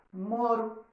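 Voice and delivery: "moro." with a single light tapped R; soft R in "moro". Shown as a demonstration. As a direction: say "moro." with the R lightly flicked by the tongue. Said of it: "a palavra fica com R brando e passa a ser pronunciada". ("moro." com R brando)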